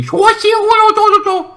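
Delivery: high, slow sing-song mangle; sing-song name. Retold or imitated. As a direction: drag it out high and silly.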